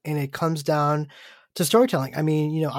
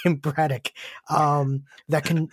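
normal talking voice

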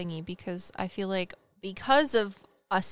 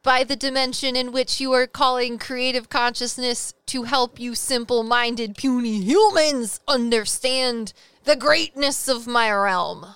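slow, grand otherworldly being; pompous spirit talking down to humans; cloud man voice; 4 semitones higher, 6 dB louder than usual. Retold or imitated.

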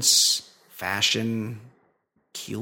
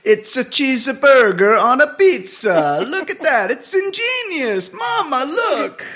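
put-on very high, loud Italian accent; jolly Italian pizza chef; 13 semitones higher, 7 dB louder than usual. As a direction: squeal with delight at the pizza. Mock Italian ad, Mama.

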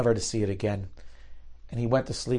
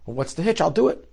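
eager acceptance; 6 semitones higher than usual. Retold or imitated.

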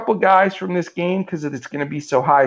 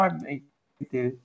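ordinary talk

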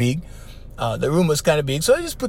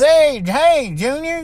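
much higher, hearty Southern-style hey; the friendly Gomer Pyle hello, pitched way up; Jim Neighbors voice; 10 semitones higher, 3 dB louder, slower than usual. Retold or imitated. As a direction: lift into a sing-song TV-star greeting. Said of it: "he talk like that".

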